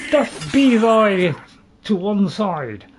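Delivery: slow, loud, old-fashioned mock speech; drawn-out mock medieval speech. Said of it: "In my best medieval voice".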